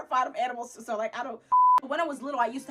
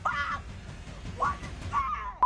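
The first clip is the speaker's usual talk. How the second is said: high squeal of disbelief, climbing higher; shocked; slower than usual. Retold or imitated. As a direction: shriek up into falsetto in alarm. Acted shock at fuzzy creatures.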